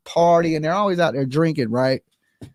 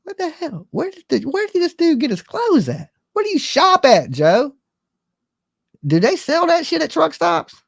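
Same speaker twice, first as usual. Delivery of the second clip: incredulous rant; 11 semitones higher than usual. Pretend. Ranting at Joe's outfit.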